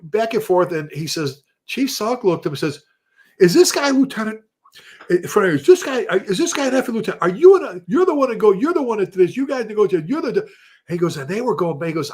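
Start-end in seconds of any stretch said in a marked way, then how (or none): 3.4-10.5 loud, higher, rapid-fire berating; angry outburst. Retold or imitated. Chief berating the lieutenant over coffee, furious shout. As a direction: tear into him in front of everyone.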